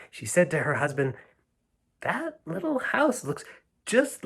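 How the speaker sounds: gentle read-aloud storytelling; a storyteller reading The Little House to children; story narration. A narrator's reading. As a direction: read it like a bedtime picture book. Reads picture book aloud.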